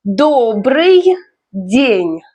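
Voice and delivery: slow Добрый день, a pause between words; pronunciation demo. Shown as a demonstration. Teacher models Добрый день for learners, slow and careful. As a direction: draw out Добрый, then день, separately. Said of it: "Let's learn pronunciation".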